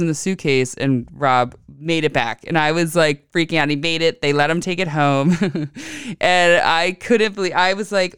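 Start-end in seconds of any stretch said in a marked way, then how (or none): none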